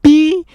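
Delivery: shrill high yelled beep over a swear; self-bleep. Shown as a demonstration. Vlogger bleeping out a swear word, high loud beep. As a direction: censor yourself with a loud bleep noise. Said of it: "I can't say the B word on YouTube".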